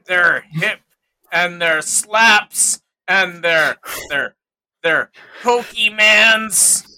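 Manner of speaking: put-on slow, loud grumbling old-timer; old prospector. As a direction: grouse about young whippersnappers. Mocking kids' slang as an oldster.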